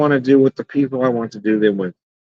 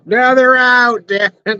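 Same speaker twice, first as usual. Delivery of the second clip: exasperated yell; 8 semitones higher, slower than usual. Real, not acted. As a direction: yell it at the bugs. Annoyed at bugs arriving.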